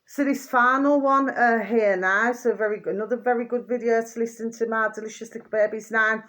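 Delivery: normal talking voice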